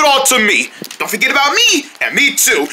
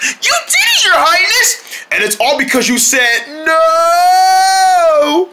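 ecstatic cheer; 7 semitones higher, slower than usual. Acted. Helper cheering the king for getting his voice back. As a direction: cheer him on.

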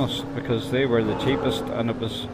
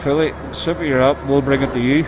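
loud, pitched-up summons; calling out; 2 semitones higher, 6 dB louder than usual. Real, not acted. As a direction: call out loudly to get their attention. Calling someone to food.

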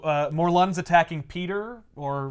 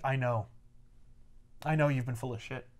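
quiet confrontation; 2 semitones lower, 7 dB quieter, faster than usual. Retold or imitated. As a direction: confront him quietly, holding it down. Peter quietly confronts lying Norman.

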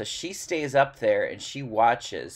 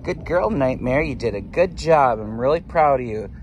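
louder, beaming praise; real proud pet-owner voice; 5 dB louder than usual. Real, not acted. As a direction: tell her she did great. Praising hen after laying.

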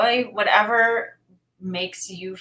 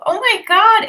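much higher, much louder thrilled cry; excited gasp; 13 semitones higher, 12 dB louder than usual. Real, not acted. Streamer thrilled by a viewer's gift, shrieking with joy. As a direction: burst out with delight at the gift.

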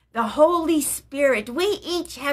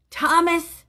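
calling his name, higher and firm; commanding; 5 semitones higher, 3 dB louder than usual. Acted. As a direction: summon him with authority. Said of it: "Jesus commanded".

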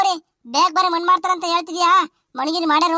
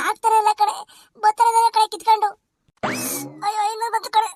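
straining in distress; 7 semitones higher than usual. Acted. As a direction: voice squeezed with effort to hold it. Losing control of gas.

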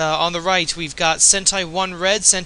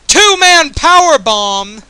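loud, high, drawn-out shout; thrilled commentator's yell; excited match call; 8 semitones higher, 9 dB louder, slower than usual. Real, not acted. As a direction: shout the big move, stretching each word. Commentator calls a big move live.

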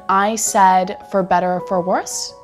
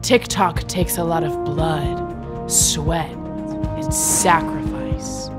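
dramatic voiceover; slower than usual. Acted. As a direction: intone it like an epic finale.

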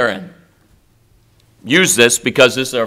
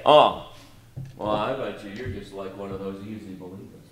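mock sneer; 5 semitones lower, 11 dB quieter than usual. Mocking a critic's remark.

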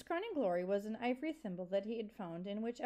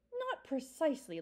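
snapped; 6 semitones higher than usual. A narrator's reading. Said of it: "Lungwort snapped".

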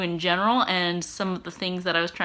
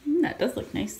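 ordinary delivery

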